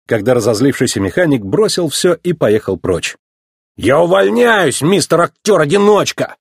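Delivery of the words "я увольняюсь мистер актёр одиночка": quit in a fit of temper; heated and raised in pitch; angry cry. A narrator's reading. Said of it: "в сердцах крикнул тот".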